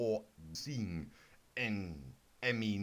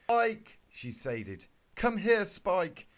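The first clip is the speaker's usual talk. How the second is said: calling Spike; 5 semitones higher, 7 dB louder, slower than usual. Acted.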